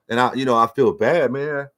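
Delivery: ordinary talk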